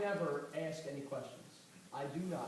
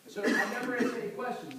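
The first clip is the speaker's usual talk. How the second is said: usual speaking voice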